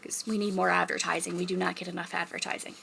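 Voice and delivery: near-whispered confidential aside; hushed aside. Real, not acted. Confiding the library lacks advertising, hushed aside. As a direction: drop to a soft half-whisper.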